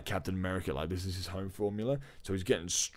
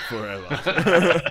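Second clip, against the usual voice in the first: slow, louder word through a laugh; 5 semitones higher, 9 dB louder, much slower than usual. Real, not acted. Joking back to cohost, laughing through the word. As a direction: laugh it out, stretched.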